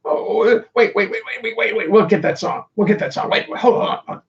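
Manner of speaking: high, frantic voice of panicking neurons; mock panic. Singer voices his neurons hunting for a song, in mock panic. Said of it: "my neurons are in a panic".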